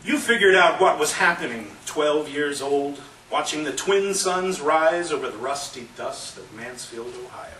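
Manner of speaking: loud, emphatic spoken-word delivery; forceful poem recitation. Acted. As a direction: punch the key phrases loud and high. Performs own poem.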